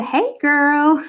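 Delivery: playful attempt at an American accent; joking, lightly done American accent. Shown as a demonstration. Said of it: "I could do the".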